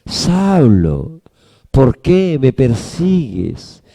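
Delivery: low, slow, tender appeal; hurt-free, loving low voice; with love. Acted. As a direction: ask it gently, full of love. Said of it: "con amor".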